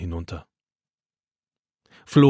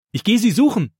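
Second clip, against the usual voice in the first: put-on excited boy; 10 semitones higher, 4 dB louder than usual. A narrator's reading.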